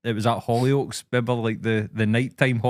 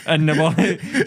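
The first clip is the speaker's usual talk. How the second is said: ordinary delivery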